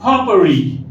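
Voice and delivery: फॉपरी said slowly and distinctly for students; teacher sounding out फॉपरी; careful pronunciation. Shown as a demonstration. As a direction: model फॉपरी clearly, falling at the end. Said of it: "इसका pronunciation है फॉपरी".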